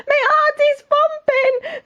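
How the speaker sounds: very high, drawn-out wail; scared cook. Acted. Cook afraid of fishing for the king, high panicky wail. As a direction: squeal it like a terrified storybook wimp.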